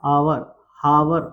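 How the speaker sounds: आवर, हावर drawn out slowly, each vowel stretched; slow demo. Shown as a demonstration. Teacher to class, slowly demonstrating आवर, हावर. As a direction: spell out आवर, हावर at half speed. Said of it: "shower means क्या होता?".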